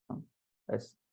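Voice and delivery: ordinary talk, nothing marked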